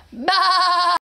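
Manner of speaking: engine bleat. Shown as a demonstration. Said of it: "the sound the tractor makes".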